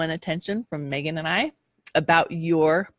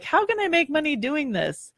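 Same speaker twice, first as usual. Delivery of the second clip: put-on high, wondering childlike questions; young dreamer voice; 9 semitones higher than usual. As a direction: imitate your younger self dreaming. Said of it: "I was like".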